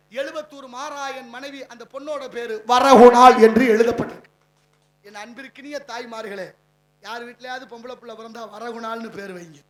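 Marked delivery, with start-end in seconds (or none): 0.1-4.3 loud, forceful proclaiming; rising oratory shout. Real, not acted. Orator to crowd, announcing the inscription with a booming shout. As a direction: drive the name home to the crowd.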